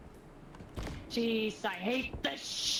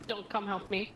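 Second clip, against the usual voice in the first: usual speaking voice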